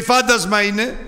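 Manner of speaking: high, loud shout of fear; the disciples cry out in fear; frightened cry. Retold or imitated. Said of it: "που λέει". (φοβισμένη κραυγή)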